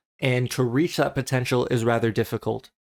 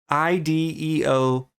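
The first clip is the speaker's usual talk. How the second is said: normal talking voice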